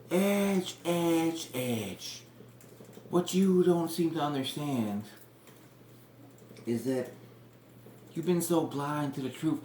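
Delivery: slow, high, drawn-out gloating; lightly voiced gloating heel manager; taunting manager. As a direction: savour each word like a smug villain. Cornette taunting Edge from ramp.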